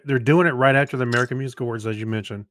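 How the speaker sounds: ordinary talk, nothing marked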